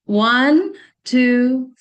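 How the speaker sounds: loud, held-out count. Shown as a demonstration. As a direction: count the group in firmly, drawing out each number. Counting group into breathing exercise.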